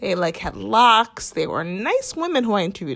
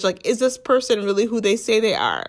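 ordinary delivery

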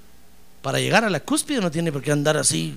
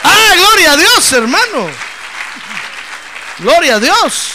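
high, loud, drawn-out praise; fervent loud preaching; preaching exclamation; 10 semitones higher, 12 dB louder, slower than usual. Real, not acted. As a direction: shout glory to God to the congregation.

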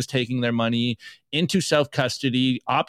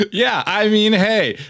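laughing, higher and louder; 8 semitones higher, 4 dB louder than usual. Real, not acted. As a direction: giggle through the words. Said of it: "laughing at the joke".

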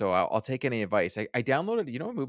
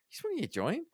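high, hushed mock busybody; nosy lady; 14 semitones higher, 6 dB quieter than usual. Imitating the lady behind them asking her husband, high and hushed. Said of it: "the lady behind us turned and was like".

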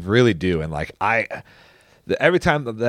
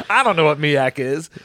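usual speaking voice

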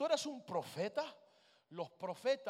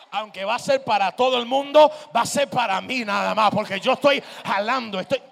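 loud, insistent declaration; full-force preaching; 4 semitones higher, 19 dB louder than usual. Acted. Believer claiming the word for himself, full-force preaching.

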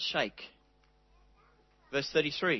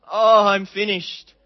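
a sighing, hopeless "Oh, I'm finished", slow and louder; mock despair; 3 semitones higher, 9 dB louder, slower than usual. Shown as a demonstration. Preacher showing a despairing reading of Jesus' words, slow and sighing. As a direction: give up, drawn out and defeated. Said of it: "not words of despair and hopelessness".

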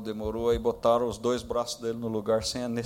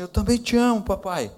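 put-on child's reply; 11 semitones higher, 8 dB louder than usual. Son answering father's love.

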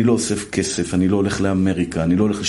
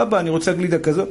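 mock child whine; 7 semitones higher than usual. Kids nagging from back seat.

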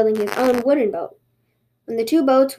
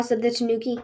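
usual speaking voice

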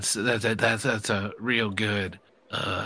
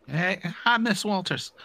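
GM greeting in a higher Southern twang; 9 semitones higher than usual. Acted. GM playing the professor, greeting the student with a Southern twang. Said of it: "I gotta get rid of that twang".

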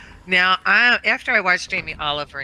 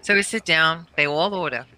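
usual speaking voice

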